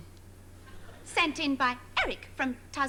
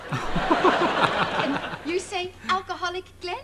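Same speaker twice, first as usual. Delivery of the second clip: slow, louder, drawn-out coaxing; mock condescending; 2 semitones higher, 4 dB louder, slower than usual. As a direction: make Glenn repeat it like a toddler. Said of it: "condescending the way they spoke".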